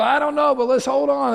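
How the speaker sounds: high, loud, hammed-up excitement; excited reply. Pastor telling congregant to hold on, loud excited mock alarm. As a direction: shout it like bracing for a wild ride.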